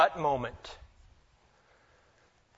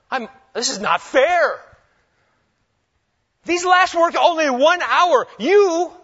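much higher, louder aggrieved outcry; angry complaint; 10 semitones higher, 8 dB louder than usual. Acted. Grumbling workers protesting to the vineyard owner, angry outcry. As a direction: rail at the unfair boss.

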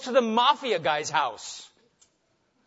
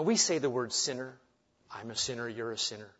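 ordinary talk, nothing marked

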